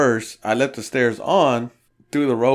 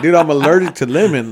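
exaggerated annoyance at a drunk friend; louder exasperated retort; 4 semitones higher, 7 dB louder than usual. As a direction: raise the voice, fed up. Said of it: "I was like".

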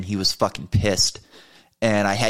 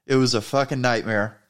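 ordinary delivery, nothing marked

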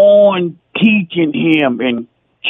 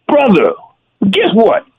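ordinary talk

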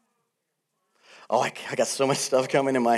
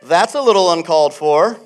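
louder, huffy tut-tut of a prim church member; mock disapproving churchgoer; 2 semitones higher, 11 dB louder, slower than usual. Preacher mimics a churchgoer offended by laughing, mock prim. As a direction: scold the laughter like an offended elder. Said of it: "they're like".

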